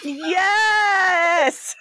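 high, drawn-out delighted yes; squealed approval; delighted cheer. Real, not acted. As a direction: cheer it like the best answer ever. Delighted reaction to the answer.

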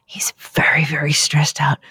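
hushed conspiratorial whisper. Acted. Telling listeners Dan is stressed, whispered secretly.

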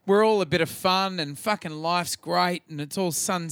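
normal talking voice

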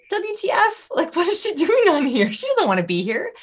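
high-pitched, incredulous reaction; baffled listener. Acted. Imagined puzzled listener reaction.

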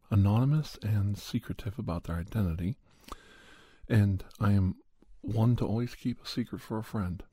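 normal talking voice

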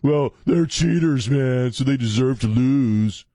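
mock the online troll, high and dragged out. Host quoting dude trolling him about the game, mocking.

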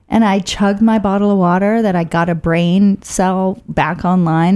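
ordinary delivery

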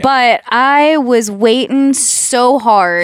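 slow, drawn-out Southern twang; accidental country accent, vowels stretched. Real, not acted. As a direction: drawl it with a lingering twang. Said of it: "You have her accent now".

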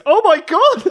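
very high, loud hammed-up shriek; mock panic. Capella joins the crowd's panicked shrieking. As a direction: scream along with the crowd, half joking. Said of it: "And then Capella goes".

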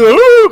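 high, loud mocking grunt. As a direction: blurt a wordless grunt to mock. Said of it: "el hombre está haciendo señas".

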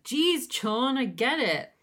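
high, loud mock exasperation; acted irritated outburst; exasperated. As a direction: cut in like someone fed up. Acted exasperated reply to singing.

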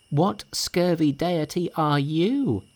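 high, slow, scornful monkey; Monkey asking. A narrator's reading. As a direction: draw out the question with contempt. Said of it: "asked Monkey".

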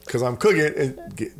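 ordinary talk

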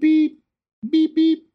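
sing-song machine beeping; imitated monitor beep. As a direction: mimic the oxygen alarm going off. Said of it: "I started hearing beep".

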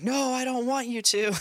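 put-on high, whining child's protest; whiny little girl. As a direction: play the stubborn daughter refusing. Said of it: "But she's like".

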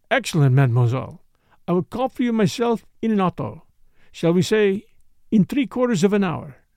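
put-on neat, precise French accent, pleased; courteous French-accented detective. Poirot arranging to call for Catherine, precise French accent.

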